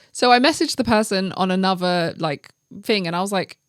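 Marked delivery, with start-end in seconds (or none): none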